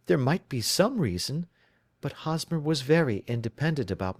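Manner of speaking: a worried bride telling her story; anxious young woman. Acted. Client's long answer.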